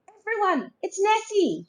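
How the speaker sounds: high, thrilled call to everyone; excited shout. A narrator's reading. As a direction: announce the discovery to the boat. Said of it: "shouted Finlay".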